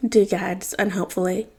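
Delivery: usual speaking voice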